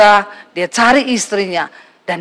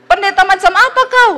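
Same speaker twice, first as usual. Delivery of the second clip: higher, accusing snap at the pastor; indignant husband; 9 semitones higher than usual. Retold or imitated. Husband on the phone rebukes the pastor, high and indignant. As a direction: demand it, offended and high.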